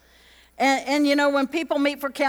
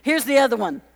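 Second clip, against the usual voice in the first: ordinary talk, nothing marked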